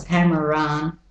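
American "Hammer on", "on" like "ahn"; American accent, linked R; American vowels. Shown as a demonstration. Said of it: "have to be the American vowels".

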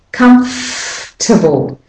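"Comfortable" slowly as "cumfff-tuhble"; held F. Shown as a demonstration. Teacher showing how to hold the F, drawn out. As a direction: stretch the f in "Comfortable" into a reduced ending. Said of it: "You hold the F on a bit longer".

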